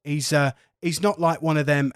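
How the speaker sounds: usual speaking voice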